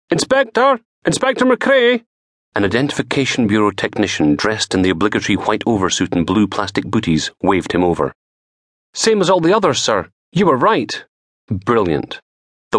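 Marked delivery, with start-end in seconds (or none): 0.1-2.0 calling out. Acted. Technician calling from afar. 8.9-11.0 a raised voice for the tech; technician voice. Acted.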